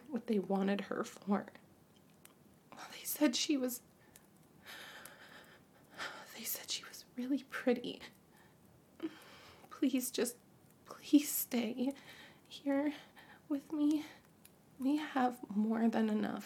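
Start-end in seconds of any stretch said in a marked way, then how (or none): 2.7-14.1 hushed, scared whisper; frightened whisper. Acted. Mother whispering her fear of the looters. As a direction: barely voice it, afraid.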